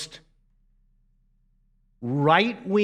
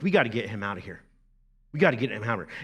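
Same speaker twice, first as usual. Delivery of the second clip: muttered plotting; 8 semitones lower, 4 dB quieter, much faster than usual. Retold or imitated. Pharisees plotting, voiced fast.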